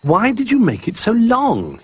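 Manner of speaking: high, small bear cub asking; high young cub's question; Little Bear voice. Acted.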